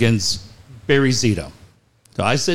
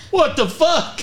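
much higher, laughing mock scream; 14 semitones higher, slower than usual. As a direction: imitate the manager screaming, half-laughing. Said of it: "He's just screaming".

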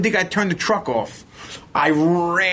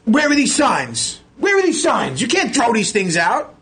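high, exasperated demand; retold angry yelling, pitch climbing; yelling, getting mad; 9 semitones higher than usual. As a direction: demand answers, worked up and high. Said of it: "as I'm yelling and screaming".

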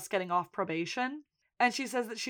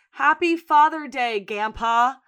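put-on childish banner reading; 3 semitones higher, 8 dB louder, slower than usual. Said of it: "a banner outside of Sheila's house that says".

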